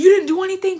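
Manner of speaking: high, loud accusing yell; yelling mom. Retold or imitated. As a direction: shriek the question at the kid. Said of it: "She's like".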